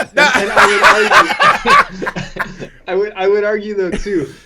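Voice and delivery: breaking into laughter mid-sentence; high, loud laugh bursting through the words. Real, not acted. Laughing through his argument.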